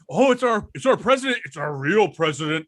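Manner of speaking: high-pitched, gushing imitation; mock excitement. Podcaster imitating a man fooled by a prank caller, mock excited. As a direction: mimic someone thrilled by a famous caller. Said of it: "he gets really excited".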